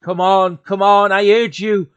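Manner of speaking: loud, high desperate plea; frantic urging. Acted. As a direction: beg the switch to work, loud and high. Narrator pleads for light switch.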